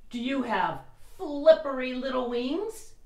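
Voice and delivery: usual speaking voice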